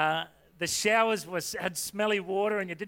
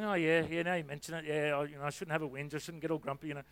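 softer, trailing sheepish agreement; sheepish mumble; 3 semitones lower, 6 dB quieter than usual. Retold or imitated. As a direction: concede quietly, a bit embarrassed. Sheepishly conceding to mate.

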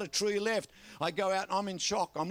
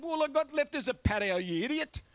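high-pitched mock indignation, dropping on the insult; comically fed up with a fool; exasperated retort; 7 semitones higher, 3 dB louder than usual. Retold exasperated retort to the boy.

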